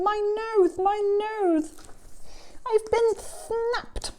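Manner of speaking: high "nose" as if held shut; pinched-nose speech. Acted. Storyteller shows why Elephant can't speak, high pinched-nose voice. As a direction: say "nose" through a trapped snout, very high. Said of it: "he can't even speak properly".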